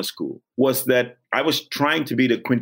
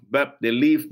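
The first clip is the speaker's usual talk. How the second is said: normal talking voice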